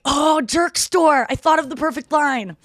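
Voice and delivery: high, loud mock realisation; eureka moment. Thought of the perfect line, excited eureka. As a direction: draw it out in delighted discovery. Acts out her own epiphany moments.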